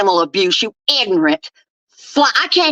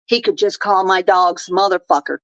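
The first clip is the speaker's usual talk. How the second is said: normal talking voice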